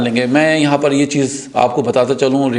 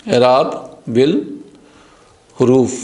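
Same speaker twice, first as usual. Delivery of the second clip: slow demo; much slower than usual. Shown as a demonstration. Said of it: "slow demo of the term".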